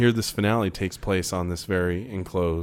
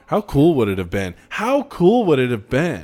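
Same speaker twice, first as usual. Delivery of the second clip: excited; 5 semitones higher, 8 dB louder than usual. Real, not acted. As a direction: build up the excitement. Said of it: "Oh, you're excited".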